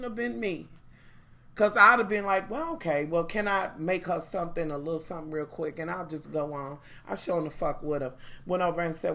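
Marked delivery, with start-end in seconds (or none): 2.5-6.8 softly, with fake politeness; mock sweet calm. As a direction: play it innocent and hushed, a little quick. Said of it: "I'd have played it so motherfucking calm".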